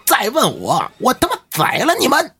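sudden high roar, retold with full force; roaring refusal. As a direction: bellow the refusal at mother and aunt. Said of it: "这老徐大吼一声". (冲着妈妈和老姨大吼拒绝)

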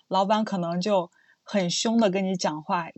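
ordinary delivery

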